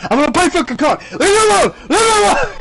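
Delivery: high, loud imitated whine; mock outburst. Harassed player wants to play, hammed-up yelling. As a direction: blow up like a fed-up player begging for peace. Retold player losing his temper.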